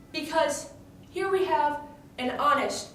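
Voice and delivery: normal talking voice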